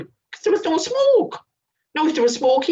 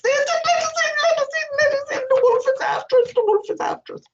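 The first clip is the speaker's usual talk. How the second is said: much higher, fast squeaky pig alarm; frightened piglets; faster than usual. Acted. Piglets warning their brother the wolf is coming.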